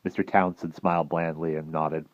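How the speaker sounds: usual speaking voice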